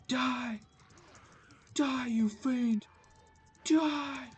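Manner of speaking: high, slow mock battle cry; hammed-up villain. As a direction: play a cartoon villain yelling die. Taunting enemies in game.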